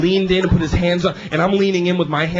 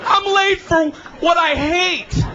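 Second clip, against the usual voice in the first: high, drawn-out comic yell; exasperated high-pitched bellow; mock wail; 11 semitones higher than usual. Mock yelling about being late.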